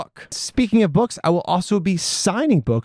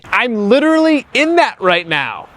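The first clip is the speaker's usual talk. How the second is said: over loud road noise, pitched up; speaking in a rumbling RV; inside an RV; 8 semitones higher, 5 dB louder than usual. Real, not acted. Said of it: "I'm recording this in an R V".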